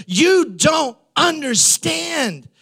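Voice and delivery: high and drawn out, pleading; high slow plea. Retold or imitated. Paul insisting to his critics, high pleading voice. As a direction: stretch it high, begging to be understood. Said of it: "And Paul says".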